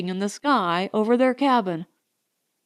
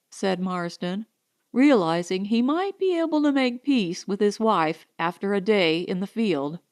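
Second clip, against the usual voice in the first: ordinary delivery, nothing marked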